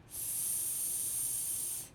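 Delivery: "S" as a long voiceless "sss"; slow sound. Shown as a demonstration. Teacher models the next sound, "S" held long. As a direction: hold the sound of "S" steadily. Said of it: "say a sound slowly".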